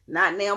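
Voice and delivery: "Not now" hit loud to set it against "right now"; emphatic "not now". Real, not acted. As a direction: punch "Not now" hard.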